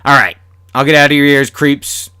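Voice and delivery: ordinary delivery, nothing marked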